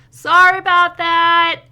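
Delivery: high, loud shouted apology; calling out. Acted. Someone inside the house calls to arriving visitors, shouted apology. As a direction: holler it out from indoors.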